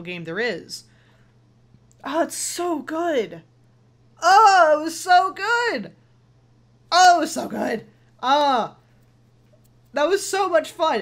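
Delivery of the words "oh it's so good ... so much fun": gushing excitement. Real, not acted. Praising the game after finishing.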